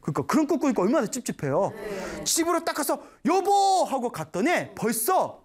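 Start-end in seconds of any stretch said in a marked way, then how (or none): 3.3-3.8 high, loud call to his wife. Retold or imitated. Husband bursting home calling his wife loudly. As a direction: shout for her as you rush in.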